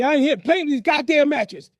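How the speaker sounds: loud, high scolding yell; angry father. Retold or imitated. Father on seeing the matchbox, angry yelling. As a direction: imitate a dad cursing in outrage.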